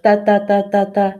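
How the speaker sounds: ta ta ta with every beat equal, quick; even Spanish rhythm. Shown as a demonstration. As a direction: tap out even beats, fast. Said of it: "with every word equal".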